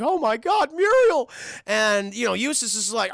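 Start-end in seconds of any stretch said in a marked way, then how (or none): 0.0-1.3 high, loud frantic cry of alarm; panicked dog. Acted. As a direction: squeal out in panic for Muriel. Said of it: "Courage is like".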